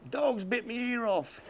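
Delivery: high, pitiful voice of the bandaged fella; whiny injured stranger. Retold or imitated. Bandaged fella telling Rod what happened, high whine. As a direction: moan it like a hurt, sorry bloke.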